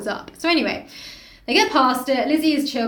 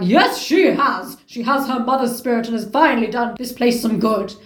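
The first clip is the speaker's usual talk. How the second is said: put-on haughty old lady; 4 dB louder than usual. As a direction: lay it on grandly. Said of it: "being like".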